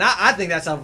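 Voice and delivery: ordinary talk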